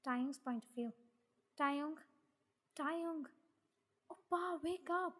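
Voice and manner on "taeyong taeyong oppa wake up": put-on higher-pitched girl's calling voice; calling to wake. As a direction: call out repeatedly to wake a sleeper. Girl calls to wake sleeper.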